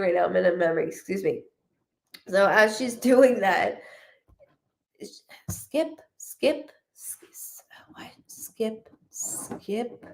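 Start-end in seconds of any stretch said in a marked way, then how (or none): 5.5-10.0 barely voiced, halting whisper; hushed relay. Psychic repeating what they say, in a hushed whisper. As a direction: whisper it in fragments, as if listening. Relaying words she hears mid-reading.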